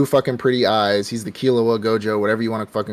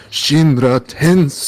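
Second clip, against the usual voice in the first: slow, drawn-out anime attack call; Pain's attack call; 4 semitones higher, 4 dB louder, much slower than usual. Retold or imitated. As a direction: intone it slowly like a villain's spell. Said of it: "half expecting him to just".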